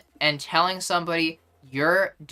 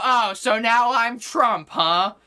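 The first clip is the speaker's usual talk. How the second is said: high, loud mock indignation; hammed-up martyr protest; mock outraged victim; 7 semitones higher, 4 dB louder, slower than usual. Streamer mimicking an accuser claiming to be hated, mock outrage. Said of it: "And they go".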